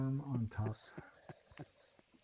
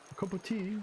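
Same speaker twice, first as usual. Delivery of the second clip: mock British accent; 6 semitones higher than usual.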